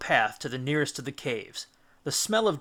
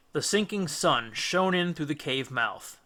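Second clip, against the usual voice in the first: ordinary delivery, nothing marked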